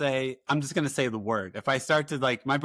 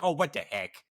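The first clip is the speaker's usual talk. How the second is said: Minnesota accent; 3 semitones higher than usual. Shown as a demonstration. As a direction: say it like someone from up north in Minnesota. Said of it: "as if I'm from Minnesota".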